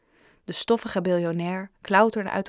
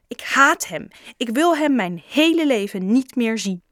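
louder, higher angry cry; furious outburst; 4 semitones higher, 5 dB louder than usual. A narrator's reading. Boy rages about his father to Raj, furious outburst. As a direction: shout it out in rage.

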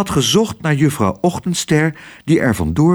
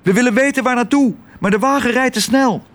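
high, fast bird voice; starling calling out; 12 semitones higher, faster than usual. A narrator's reading. Starling asks a swallow to chase the car, urgent calling. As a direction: hurry the plea out at a high pitch. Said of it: "riep de spreeuw".

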